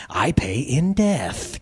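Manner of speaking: slow, savoured, dropping close; enraptured, slow. Acted. Sin savours her payment, slow and enraptured. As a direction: linger on death with relish. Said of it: "continued an enraptured Sin".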